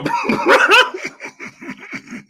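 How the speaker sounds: high, wheezing laugh; long helpless high laughter; real laughing fit. Real, not acted. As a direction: burst out laughing mid-quote. Cracks up imagining viewer.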